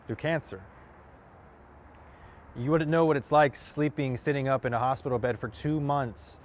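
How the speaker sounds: impassioned challenge, punching key phrases; forceful monologue. Acted. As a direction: push each point home with rising pitch and force. Same monologue, second take pushed harder.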